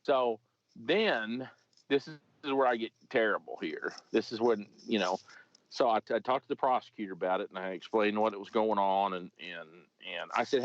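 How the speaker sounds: ordinary delivery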